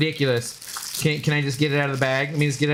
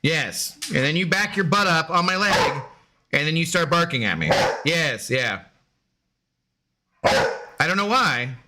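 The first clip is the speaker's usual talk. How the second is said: normal talking voice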